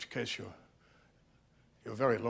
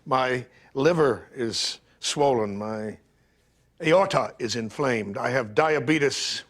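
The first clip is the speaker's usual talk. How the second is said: slow grim listing; slower than usual. Acted. Listing ailments deliberately.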